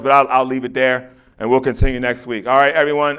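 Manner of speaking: ordinary delivery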